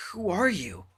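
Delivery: high startled voice that cracks; voice cracking. Acted. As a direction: let the voice break on the question. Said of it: "My voice cracks at the first res".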